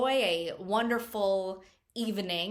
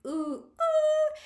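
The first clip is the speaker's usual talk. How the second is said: high swooning "ooh"; swooning squeal; 6 dB louder than usual. Retold or imitated. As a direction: squeal like a smitten fan. Girl swooning over hot guy.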